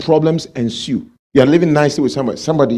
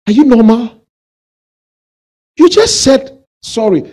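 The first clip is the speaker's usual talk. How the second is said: much higher, louder disbelieving rebuke; retold rebuke to the wife, high and loud; incredulous scolding; 8 semitones higher, 7 dB louder than usual. Pastor retells scolding the lady.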